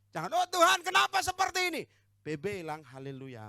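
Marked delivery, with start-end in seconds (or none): 0.3-1.8 high, loud wail of complaint; hammed-up woe-is-me outcry; mock lament. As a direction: cry out to God like an exaggerated victim. Mimics complainer who lost a phone.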